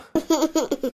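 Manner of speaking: high, bright hearty laughter. A narrator's reading. Family laughing at the boy's remark, hearty. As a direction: laugh warmly together. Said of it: "Da mussten alle herzlich lachen".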